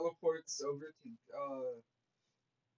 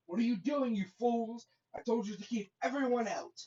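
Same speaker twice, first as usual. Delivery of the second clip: higher, louder telling-off; angry scolding; 8 semitones higher, 7 dB louder than usual. Acted. Orochimaru to his guards who let Naruto through, angry scolding. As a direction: scold your useless henchmen in a raised voice.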